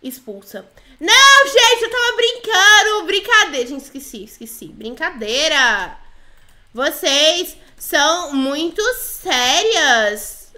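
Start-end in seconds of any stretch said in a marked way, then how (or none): 1.0-3.7 high, loud protest; panicked backtracking. Pretend. Player begs after being threatened with expulsion, in loud panic. 6.7-10.5 "Vocês são muito sérias" stretched out, very slow word by word; slow dictation. Pretend. Player types "Vocês são muito sérias" to the girls, dictating very slowly. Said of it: "Falei assim, vocês são muito sérias".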